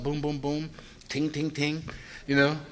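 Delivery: ordinary talk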